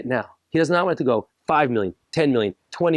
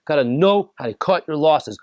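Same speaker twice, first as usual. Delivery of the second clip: usual speaking voice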